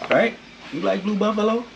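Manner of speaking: high sing-song voice for the cat; high pet-talk voice. Real, not acted. Talking to the cat, high pet-talk voice.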